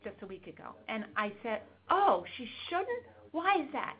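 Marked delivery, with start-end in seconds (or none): 1.9-3.9 mock wonder. Therapist coaxing the kid.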